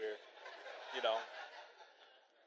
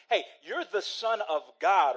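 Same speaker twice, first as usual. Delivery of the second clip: "you're the Son of God" pitched up as a challenge; higher, emphatic statement on "you're the Son of God"; stated, not questioned; 4 semitones higher, 9 dB louder than usual. Acted. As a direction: declare "you're the Son of God" like an established fact. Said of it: "He's making a statement".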